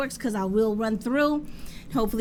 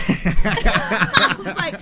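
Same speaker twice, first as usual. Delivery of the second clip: louder words through laughter; laughing; 5 semitones higher, 6 dB louder, slower than usual. Real, not acted. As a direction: laugh while brushing him off. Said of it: "I'm laughing".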